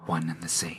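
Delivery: whispered, trailing into breath; hushed. Acted. Death's agent speaks of life and death, hushed. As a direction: let it sink to an ominous whisper.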